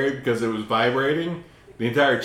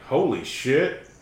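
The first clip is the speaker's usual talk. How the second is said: stunned retold gasp; 3 semitones higher, much slower than usual. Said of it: "And I was like".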